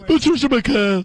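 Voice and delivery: mock ad sign-off. Shown as a demonstration. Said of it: "read how she relaxes down".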